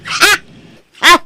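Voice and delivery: put-on loud, high-pitched scolding of the children; exasperated scolding. Mother scolds her children for not cooperating, loudly exasperated. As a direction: raise your voice, fed up with them.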